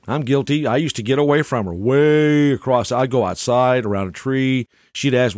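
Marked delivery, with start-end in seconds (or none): none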